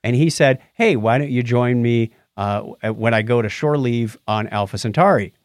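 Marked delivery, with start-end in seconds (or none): none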